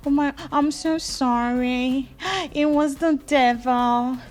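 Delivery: slow, whiny put-on pleading; mock pleading. Ruth begging her boyfriend for forgiveness, slow mock whine. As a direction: drag out the apology pitifully. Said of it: "Ruth was now saying".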